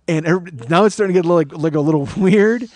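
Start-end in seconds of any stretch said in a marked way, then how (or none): none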